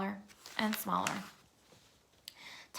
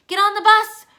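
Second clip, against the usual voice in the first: loud, high urgent shout; yelling; 11 semitones higher, 14 dB louder than usual. A narrator's reading. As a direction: yell the order in a rush. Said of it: "Ruth Rose yelled".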